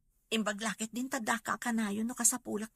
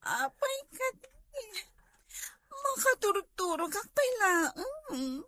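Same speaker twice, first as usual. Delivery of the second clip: high, tearful and broken; crying; 9 semitones higher than usual. Acted. Wife just woken by her husband, crying high and tearful. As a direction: sob the words out, pitch way up.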